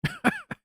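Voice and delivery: short high-pitched laugh; a quick squeaky laugh; high quiet laugh. Real, not acted.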